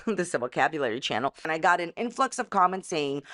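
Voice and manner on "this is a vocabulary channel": quick laughing aside. Acted. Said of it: "running joke aside".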